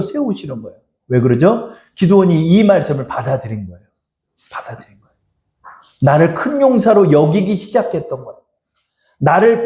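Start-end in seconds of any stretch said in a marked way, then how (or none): 4.5-5.1 hushed whisper. Real, not acted. Preacher echoing his point to the congregation in a whisper. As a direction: whisper it, much softer.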